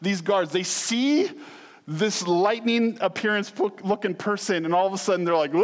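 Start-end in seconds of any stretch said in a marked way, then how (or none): 5.5-5.6 imitated high shriek, cut off into a faint; mock scream. Preacher mimicking guards seeing the angel, mock scream. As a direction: shriek like a guard about to drop. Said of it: "that's how I envision these guys".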